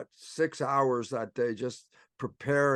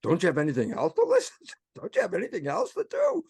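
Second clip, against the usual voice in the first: amused mock inner voice; 6 semitones higher, 4 dB louder, faster than usual. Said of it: "that was the funny part".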